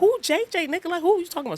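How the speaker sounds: high-pitched disbelieving question; high incredulous. Retold or imitated. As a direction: squeak it out in disbelief. Friends retort, high pitched.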